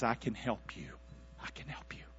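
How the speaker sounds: low and soft, sinking into a whisper; hushed reassurance. Acted. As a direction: offer help quietly, then whisper it. Peter's reassurance, repeated softly.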